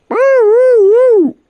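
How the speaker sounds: loud high whoops; whooping. Real, not acted. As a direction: whoop it out like a party. Celebratory whoop at sign-off.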